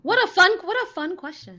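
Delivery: high, loud delighted exclaim; excited. Real, not acted. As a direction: burst out delighted. Delighted remark on the question.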